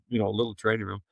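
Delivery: ordinary delivery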